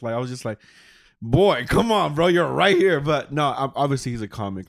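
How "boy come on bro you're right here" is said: louder, higher mock objection; exasperated come-on, played light; retold protest. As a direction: call him out, half joking. Retelling protest at comic's insults.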